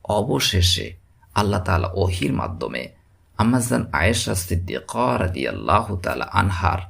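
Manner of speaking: usual speaking voice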